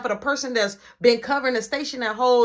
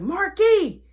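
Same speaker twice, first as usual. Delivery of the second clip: high, loud call up the stairs; 11 semitones higher, 4 dB louder than usual. Retold or imitated. Mom calling her son upstairs, high and loud. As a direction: sing his name out to fetch him.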